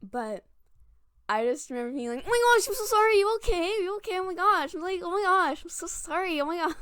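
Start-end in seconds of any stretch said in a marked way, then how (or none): 2.2-6.8 high, loud, gushing mock panic; retold fluster, pitched up high; flustered apology. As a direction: apologize frantically, acting mortified. Retold panicked apology after fake trip.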